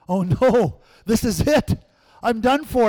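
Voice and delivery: high, loud panicked cry. Retold or imitated. Scared boy alone at home panics, high and loud. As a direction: sound like the rapture just left you behind.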